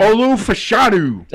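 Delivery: Olu Fashanu in a broad, slow Jersey accent. Shown as a demonstration. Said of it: "I'm saying it with my Jersey accent".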